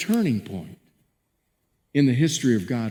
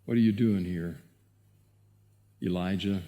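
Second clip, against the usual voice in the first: soft, hushed voice of God, much lower; divine whisper; 9 semitones lower, 6 dB quieter than usual. Acted. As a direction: breathe the question gently, like a still small voice. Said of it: "The divine whisper".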